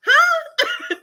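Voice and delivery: high, laughing puzzled huh; a baffled huh through laughter. Retold or imitated. Confused at husband's motioning.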